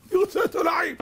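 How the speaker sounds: high squeaky chameleon demand. Retold or imitated. The chameleon tells Noah what it eats, high and squeaky. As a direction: squeak it like a fussy little lizard. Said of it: "אמר".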